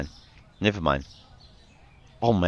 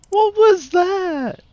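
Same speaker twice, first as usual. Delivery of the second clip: startled; much slower than usual. Real, not acted. Reacting to strange game noise.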